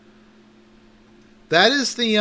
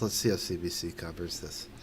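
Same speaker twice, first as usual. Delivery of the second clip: softer, lower mutter to himself; muttered repeat; 7 semitones lower, 10 dB quieter than usual. Shown as a demonstration. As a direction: mumble it under your breath.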